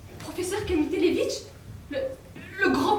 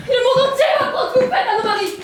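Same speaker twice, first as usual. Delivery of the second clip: loud, much higher shout; furious shout; 6 semitones higher, 6 dB louder than usual. Acted. Wife shouting back at the magician's assistant, furious. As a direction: hurl it back at her.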